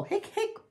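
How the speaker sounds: very high, squeaky hics; hiccups. A narrator's reading. Hippo can't stop after fizzy pop, high hiccups. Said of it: "I'm hiccuping bubbles".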